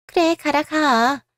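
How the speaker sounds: slow, high, bitter send-off; bitter dismissal. Acted. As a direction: spit it out slowly, dismissing him. Mother throws son out. (느리고 높게, 비꼬듯 내쫓는 말투)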